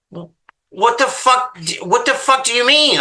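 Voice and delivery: high, stunned disbelief; incredulous outburst. Retold or imitated. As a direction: shriek out what on earth he means. Outraged at motel husband.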